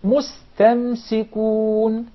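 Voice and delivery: mustamsikun very slow, last vowel held; drawn-out recited mustamsikun; two clear sins. Shown as a demonstration. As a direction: sound both S's in slow mustamsikun. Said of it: "there are two scenes in this word".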